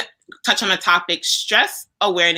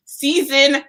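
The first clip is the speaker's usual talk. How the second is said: higher, bright announcement; proud reveal of the title; announcing big news; 10 semitones higher, 6 dB louder than usual. Real, not acted. Announcing season two.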